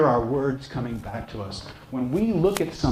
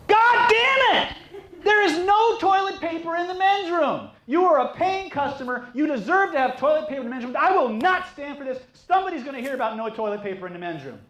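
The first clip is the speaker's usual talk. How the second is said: matching outrage. Acted. Clerk matching the furious bodybuilder's outburst, high outraged yell. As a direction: holler indignantly on the customer's behalf, pitch way up. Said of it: "Match him".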